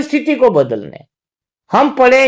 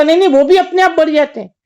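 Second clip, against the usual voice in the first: lightly voiced king, higher and faster; king's quick denial; 6 semitones higher, faster than usual. King answers the fakir's teasing, quick high-pitched denial. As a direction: wave the idea away in a quick, raised voice.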